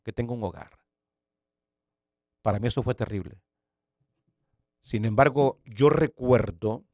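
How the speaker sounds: usual speaking voice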